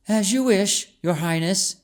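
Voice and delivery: bow with your voice to the princess; dutiful maid. A narrator's reading.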